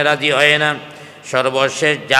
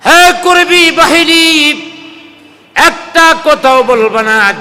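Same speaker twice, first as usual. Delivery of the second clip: slow, high, loud command; raised voice, stretched-out order; calling out; 11 semitones higher, 6 dB louder than usual. Retold or imitated. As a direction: call the henchmen to order, drawn out. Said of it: "Abu Jahl ডাক দিয়া বলে".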